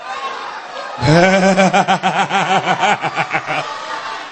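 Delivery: drawn-out rippling laughter; long chuckle. Real, not acted. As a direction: let a rapid chuckle run on and fade. Preacher laughing during session.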